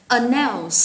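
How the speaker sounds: "Announce" with the weight on "-nounce"; stress on second syllable. Shown as a demonstration. Teacher modelling word stress for students, "Announce" stressed on syllable two. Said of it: "nhấn trọng âm ở âm hai".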